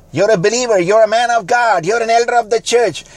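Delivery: high, loud and ringing sermon voice; full-force preaching. Acted. Preacher rousing the congregation, high and loud. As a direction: preach it out to the whole room.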